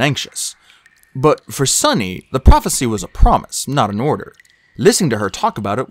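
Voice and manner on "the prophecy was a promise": ordinary delivery, nothing marked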